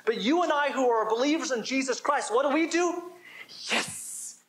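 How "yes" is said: breathy hushed yes; whispered yes. Shown as a demonstration. Preacher showing believers how to answer, whispered yes. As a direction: breathe it like a secret win.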